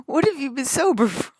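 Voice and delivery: loud, high, disbelieving retort; incredulous shout. Retold or imitated. Comic challenging a stoner's sober claim, loud and incredulous. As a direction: fire back in loud disbelief.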